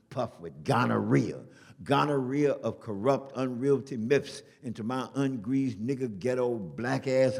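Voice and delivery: forceful loud declamation, climbing to a shouted last word; poem recital. Acted. Poet addresses Uncle Sammy in anti-war poem, reciting. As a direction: hammer out the dense verse, building to an outburst. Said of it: "So I went on to recite this poem".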